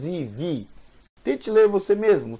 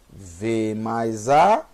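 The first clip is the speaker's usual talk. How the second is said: V mais A stretched very slowly, lower; slow spelling out; 7 semitones lower, much slower than usual. Shown as a demonstration. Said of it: "slow spelling demo".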